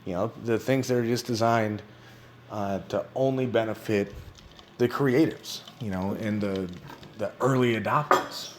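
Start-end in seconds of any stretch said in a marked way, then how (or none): none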